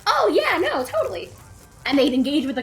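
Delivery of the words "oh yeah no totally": much higher, louder mock enthusiasm; eager agreement. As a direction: gush along like an eager classmate. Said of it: "everyone else would be like".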